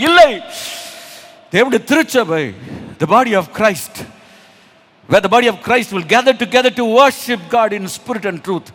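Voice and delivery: forceful preacher addressing the flock; impassioned preaching. Acted. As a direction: press the questions on the congregation with force.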